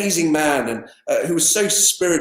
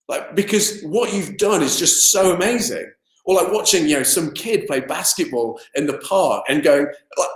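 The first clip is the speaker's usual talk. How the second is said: normal talking voice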